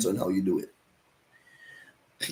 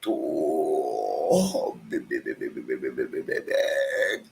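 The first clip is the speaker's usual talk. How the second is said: low, softer moaning seller's call for cold water; lower, softer vendor moan; 9 semitones lower, 3 dB quieter than usual. Shown as a demonstration. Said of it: "it's like this".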